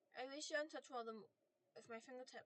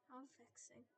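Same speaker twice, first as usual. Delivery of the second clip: growled; 9 dB quieter than usual. Acted. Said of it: "The voice growled".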